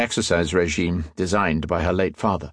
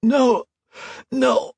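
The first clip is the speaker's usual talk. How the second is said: choking. A narrator's reading. Priest being strangled, high and choking. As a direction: choke out the words in terror. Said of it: "Mauger choked".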